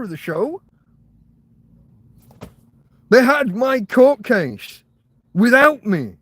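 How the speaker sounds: real exasperated shouting rant; angry rant. Real, not acted. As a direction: let the frustration boil over. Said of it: "I'm getting angry. Sorry, folks. I'm shouting".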